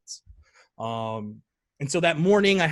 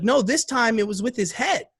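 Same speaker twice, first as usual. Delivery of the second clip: put-on high child's correction; imitated child, pitched up; insistent little girl; 8 semitones higher than usual. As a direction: insist on it like a small kid. Daughter correcting dad.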